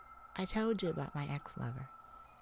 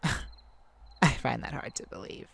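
slow, low, chuckling through it; lower, laughing skeptic; amused disbelief; 5 semitones lower, 3 dB louder, slower than usual. Acted. Laughs at the claim.

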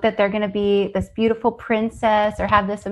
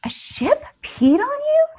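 high, startled "ship"; mock surprise. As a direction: play it up as startled therapist. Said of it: "And I was like".

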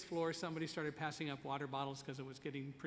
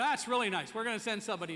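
insistent firefighter; 5 semitones higher, 7 dB louder, faster than usual. Retold or imitated.

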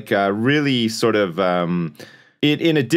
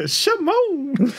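very high falsetto pop-star yelp. Acted. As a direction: squeal it like a catchphrase. [name] catchphrase and vocal noises.

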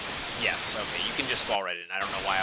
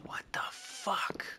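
stunned whisper; 5 dB quieter, slower than usual. Real, not acted. Shocked by game.

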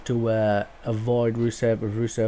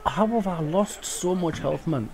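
higher-pitched disbelief; incredulous complaint; 5 semitones higher than usual. Real, not acted. Gamer complaining about lost health, high incredulous tone. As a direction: ask it like the game cheated you.

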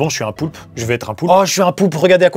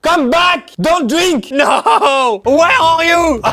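laughing shout; 4 semitones higher, much slower than usual. Real, not acted. Chasing a drunk player around, laughing shout. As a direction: yell after him while cracking up.

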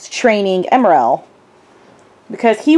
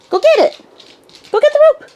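high-pitched, excited fetch command; high, eager play talk; egging a dog on; much faster than usual. Real, not acted. Sending the dog after a toy.

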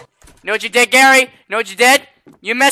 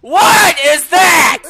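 startled shout; 7 semitones higher, much slower than usual. Real, not acted. Player startled by a sudden explosion, shouting. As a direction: shout in shock.

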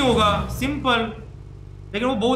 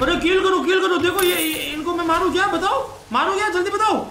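urgent call; 6 semitones higher than usual. Real, not acted. Urgent mid-fight call.

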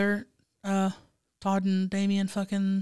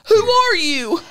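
high, loud, baffled falsetto; Greg; 7 dB louder, slower than usual. Acted. Character questioning Damien, high loud bewildered squeal. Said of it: "in my Greg voice".

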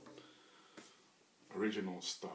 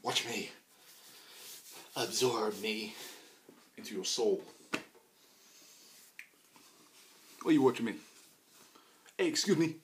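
loud teasing; 3 semitones higher, 7 dB louder than usual. Real, not acted. Talking to viewers, loud high.

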